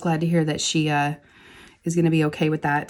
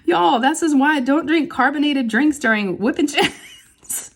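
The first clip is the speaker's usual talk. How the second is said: laughing; 8 semitones higher, slower than usual. Real, not acted. Laughing after burping on camera.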